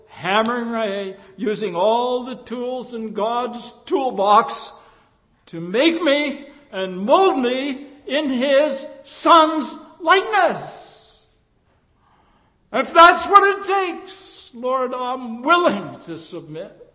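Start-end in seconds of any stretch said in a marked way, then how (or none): 5.5-14.5 higher, louder, slower preaching climax; rising fervor. Acted. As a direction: build it up with fervor, slowly.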